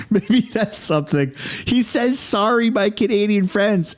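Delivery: high voice just after laughing; amused. Real, not acted. Streamer repeating the American's apology to chat, amused. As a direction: speak high, still tickled from laughing.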